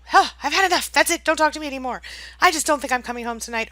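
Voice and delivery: loud, high fed-up outburst; mock outrage. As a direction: imitate someone blowing up and cutting off the call. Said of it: "it starts getting more and more, uh, you know, outrageous".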